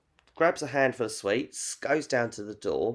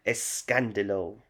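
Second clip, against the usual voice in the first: put-on light telenovela Spanish accent; 3 semitones lower than usual. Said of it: "Like the accent, a bit foreign".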